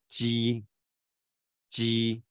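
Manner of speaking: 鸡 held long with a palatal j; drawn-out, clearly articulated 鸡; tongue-surface consonant. Shown as a demonstration. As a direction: model 鸡 slowly, j on the tongue surface. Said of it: "其实是舌面音啊".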